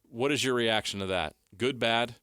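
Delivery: ordinary talk, nothing marked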